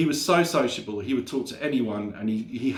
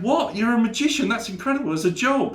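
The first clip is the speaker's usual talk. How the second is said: much higher, astonished and gushing; amazed high voice; 11 semitones higher, 4 dB louder than usual. Acted. Rob thrilled to meet a magician, high and amazed. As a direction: squeal it like it's the best news ever.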